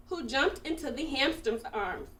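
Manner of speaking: ordinary delivery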